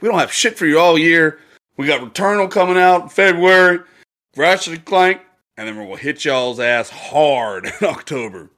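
high, loud boastful company voice; mock company voice. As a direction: hype it up like a publisher. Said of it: "It was like".

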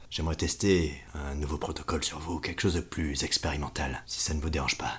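soothing, seductive doctor's pitch; suave voice. Acted. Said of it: "il a une voix très suave".

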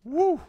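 high-pitched "woo"; excited whoop. Real, not acted. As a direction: let out a short, high whoop. Reacting to a racy film scene.

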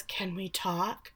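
very soft and slow; tentative request. Retold or imitated. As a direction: ask it timidly, almost under the breath.

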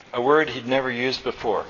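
usual speaking voice

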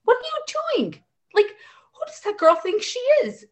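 high, disbelieving what-are-you-doing; shrill-free but sky-high disbelief, imitated; incredulous outrage. Mom voicing her outraged thoughts.

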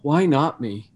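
steady, matter-of-fact question; calm reframe. Retold or imitated. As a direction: ask it calmly, no self-pity. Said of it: "switch that ever so subtly to".

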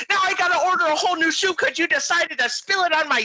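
very high, outraged Midwestern wail; hammed-up angry Midwest accent. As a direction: mimic an angry Midwest lady. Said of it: "because she was so angry".

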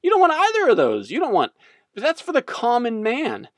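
high-pitched snob brand butting in; Voss. Acted. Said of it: "And then Voss pops in".

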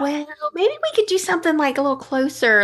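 mock-coaxing, pitched well up; tentative suggestion. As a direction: float the idea up high, imitating her. Voicing her tentative suggestion to him.

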